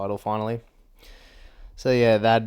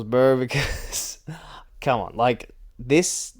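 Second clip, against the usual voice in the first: ordinary delivery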